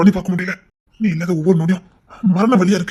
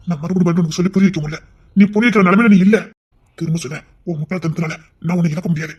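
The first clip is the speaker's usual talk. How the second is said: ordinary talk, nothing marked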